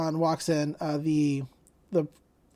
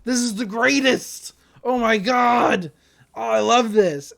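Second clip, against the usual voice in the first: loud gushing, raised pitch; super impressed; 3 semitones higher, 5 dB louder than usual. Retold or imitated. Student raving over the name she picked, loudly gushing. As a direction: act out over-the-top delight. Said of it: "he's just super impressed all the time".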